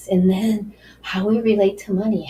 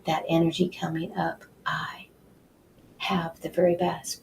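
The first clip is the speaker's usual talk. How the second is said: whispered affirmation, breathy and intimate; 7 dB quieter than usual. Shown as a demonstration. Guide whispering the affirmation to the listener, whisper. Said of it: "as you go through the day".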